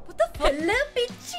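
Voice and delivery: high, loud startled cry; shocked outburst. Acted. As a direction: yelp it in disbelief.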